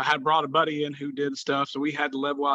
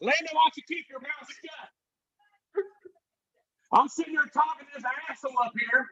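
wrestling promo rant; 9 semitones higher than usual. Pretend. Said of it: "to cut a promo on your boy right now".

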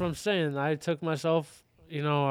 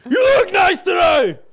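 shouted compliment, high and loud; angry happy; 13 dB louder than usual. Pretend. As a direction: yell a nice thing like an angry drunk. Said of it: "I'm about to turn to an angry happy".

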